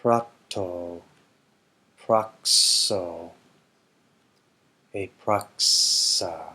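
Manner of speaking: slow deliberate recitation; slow principal parts. Shown as a demonstration. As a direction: recite each form carefully for learners. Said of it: "reads principal parts".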